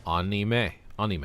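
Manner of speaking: "Anime" opening on ah, ah-nee-meh; Japanese pronunciation. Shown as a demonstration. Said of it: "I said it like that".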